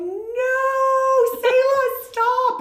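loud, high, drawn-out inner cry; panicked inner voice. Retold or imitated. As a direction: mock-desperate yell to stop yourself. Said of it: "and inside going".

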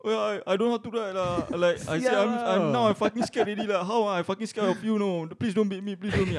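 high fake-scared trolling; mock scared. Rider trolling the driver, high fake fear. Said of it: "I just troll him".

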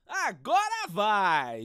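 slow, loud, stretched cry; hopeful excited call. Acted. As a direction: build up the restart.

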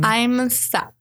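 put-on whiny teen excuse; teenager voice. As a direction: play a moping teenager. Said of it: "Our teenager impression is getting so good".

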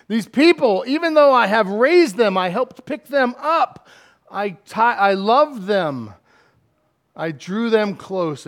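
high, loud exasperated venting. Acted. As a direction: vent at full voice, easing off at the end. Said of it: "He's venting and saying".

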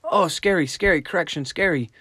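voicing a frightened dog; mock scared puppy. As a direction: play the puppy panicking at the pressure. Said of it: "they don't just say".

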